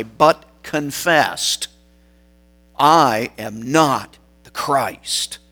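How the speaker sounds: slow, weighty emphasis on every word; emphatic. Retold or imitated. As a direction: lay each word down slowly and firmly. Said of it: "is emphatic".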